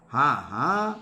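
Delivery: low mock laugh, ha ha; lightly acted mocking laugh; birds laughing. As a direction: laugh at the demon's name in a low voice. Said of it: "survey విహగాః అహసన్".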